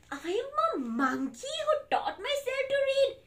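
high silly monkey voice; monkey character. Acted. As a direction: announce it as a goofy monkey. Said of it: "with my monkey mouth".

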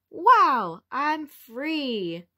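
delighted butterfly escaping; excited wow. Acted. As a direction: burst out free and thrilled.

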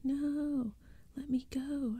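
high-pitched struggling cry; shrieking. A narrator's reading. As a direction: cry out to get free. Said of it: "I shrieked".

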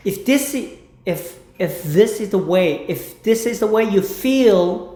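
very slow phrase practice; slow drill. Shown as a demonstration. Teacher drills the phrase slowly. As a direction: draw each word out. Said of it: "slow repetition drill".

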